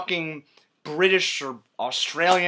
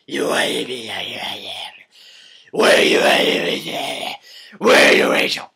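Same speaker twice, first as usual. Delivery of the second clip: unintelligible growling shout; 6 semitones lower, 6 dB louder than usual. Acted. Batman demanding answers from the Joker, growled and garbled. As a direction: roar the words into a garbled growl.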